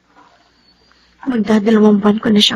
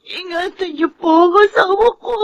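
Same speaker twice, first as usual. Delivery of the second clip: crying prayer; 5 semitones higher than usual. Acted. Wife prays for her husband's safety, sobbing and crying out. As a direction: beg God through tears.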